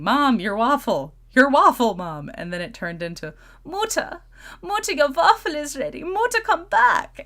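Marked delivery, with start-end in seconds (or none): 0.0-2.3 loud calling yell; yelling for mom. Retold or imitated. Daughter yelling after her mom in the garden, loud call. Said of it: "I was just yelling". 3.6-7.2 high, loud hammed-up German yell; Gunther German accent. Daughter calls her mother back to the waffle, hammed-up German yell. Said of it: "that was the beginning of Gunther".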